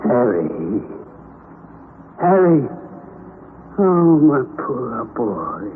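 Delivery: put-on slow, tender call over a fainting boy. Uncle cradling his fainted nephew, tender and slow. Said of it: "lift me tenderly in his arms".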